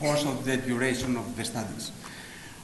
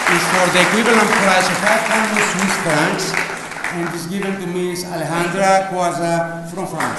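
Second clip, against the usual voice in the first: prize announcer; 3 semitones higher, 8 dB louder than usual. Real, not acted. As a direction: call the winner's name out to the whole hall. Announces prize winner at ceremony.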